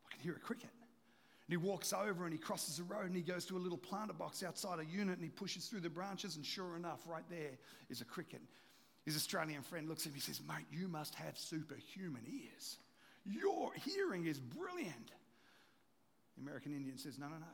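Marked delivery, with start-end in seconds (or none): 0.1-0.7 soft, near-whispered claim; hushed insistence. Acted. American Indian insisting to his friend, hushed. Acted quiet insistence in story. 10.4-15.1 slow, whispery wonder; hushed amazement. Acted. Australian friend marvelling at his hearing, hushed and amazed.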